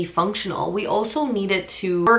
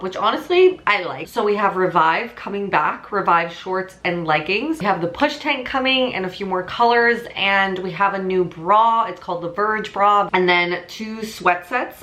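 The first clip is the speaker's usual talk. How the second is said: normal talking voice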